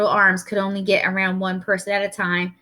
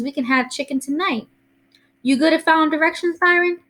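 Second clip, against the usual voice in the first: higher-pitched granny voice, fussing; bustling Southern grandma; 5 semitones higher than usual. Acted. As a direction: fuss like a grandma planning dinner, then put the question to the boy.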